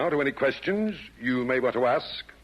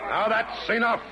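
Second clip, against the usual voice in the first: stern stop; 9 semitones higher than usual. Acted. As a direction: shut the quarrel down. Chairman stops the insults.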